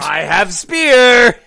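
loud broken-English brute; brutish barbarian voice. Acted. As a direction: bellow it like a dim warrior. Barbarian offers spear.

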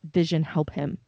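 low, soft urgent order; serious command. Retold or imitated. Wanda urging Vision to help the man, low and hushed. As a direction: drop the sitcom voice, go grim. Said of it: "she stops sort of being Wanda".

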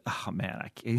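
low, soft mock disbelief; feigned dismay. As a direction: murmur it as bait to tease a colleague. Retold teasing of Manny, feigned dismay.

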